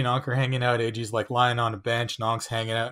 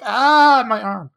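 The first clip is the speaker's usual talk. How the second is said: pained cry; 9 semitones higher, 7 dB louder, much slower than usual. Retold or imitated. Ankh pulled from the tree.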